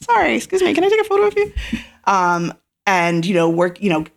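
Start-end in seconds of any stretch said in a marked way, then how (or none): 0.0-1.5 sheepish polite asker. Retold or imitated. Retold asking strangers for photos.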